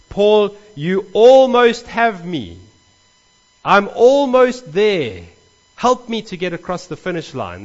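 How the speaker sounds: sincere almost-convinced plea. Shown as a demonstration. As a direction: beg him sincerely to help you over the line. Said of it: "That's how the commentaries put it across".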